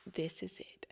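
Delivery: breathless whisper of realization; awed whisper. Retold or imitated. Guest seeing the sign on the box, whispering in awe. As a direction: whisper it like a revelation.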